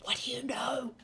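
hushed secret whisper; soft, furtive question under the breath. Acted. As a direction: whisper it to just one person. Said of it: "She's gonna try and whisper it".

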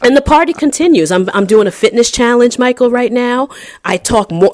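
loud and high with excitement; bursting with excitement; excited. Real, not acted. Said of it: "I'm so excited".

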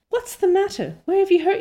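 high-pitched urgent questioning; acted demand. A narrator's reading. Missus Barry to injured Anne, acted demand. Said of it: "demanded Missus Barry".

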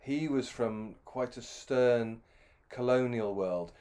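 ordinary delivery

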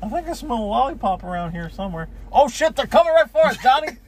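high, loud cartoon raccoon. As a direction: squeal like a greedy little raccoon. Voicing the raccoons.

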